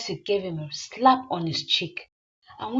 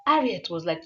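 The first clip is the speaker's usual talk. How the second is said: ordinary delivery, nothing marked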